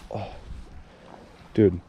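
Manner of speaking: usual speaking voice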